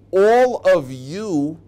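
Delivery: ALL OF YOU drawn out high and loud; slow stress on ALL. Shown as a demonstration. Key phrase drawn out.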